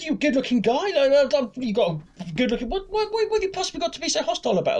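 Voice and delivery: high-pitched flattering coaxing; butter him up in a put-on caring voice; feigned concern. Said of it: "trying to make out I sort of".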